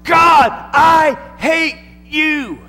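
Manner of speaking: high, loud, slow anguished shout at God; raging cry of a grieving mother; angry cry. Retold or imitated. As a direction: scream it out in grief and rage. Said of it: "she cried out".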